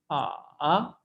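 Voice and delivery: आ dipping low and coming back up; third tone dip. Shown as a demonstration. Teacher models a dipping third-tone आ. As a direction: drop आ into the throat, then rise. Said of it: "गले में फंसने की सूरत में खराश".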